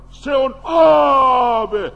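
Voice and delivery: loud frightened cry of a ghost; terrified shout. Acted. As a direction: shout it like you've seen a ghost. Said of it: "ja huusivat pelosta". (kauhistunut huuto)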